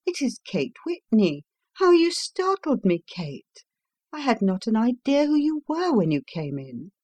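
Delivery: a hint of a surprised hostess; wife greeting her startled old school friend; wife's voice. A narrator's reading. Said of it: "said my wife".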